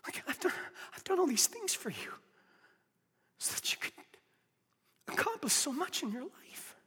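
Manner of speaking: soft, near-whispered pleading; a preacher going quiet to plead with the church; hushed preaching. Acted. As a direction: drop to a hush and draw them in. Hushed intimate preaching after a climax.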